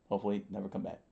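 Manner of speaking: ordinary delivery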